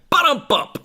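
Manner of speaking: high, loud drum-hit imitation; vocal rim shot. Shown as a demonstration. Actor punctuating a joke for listeners, vocal rim shot. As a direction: punch it out like a comedy drum sting. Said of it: "just do a rim shot for you".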